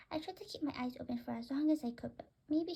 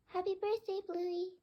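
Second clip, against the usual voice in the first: put-on higher girl voice; 8 semitones higher than usual. Elizabeth greets the bird.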